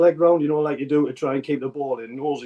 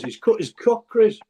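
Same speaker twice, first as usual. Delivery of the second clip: retold shout; 8 semitones higher, slower than usual. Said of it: "I've shouted to Chris".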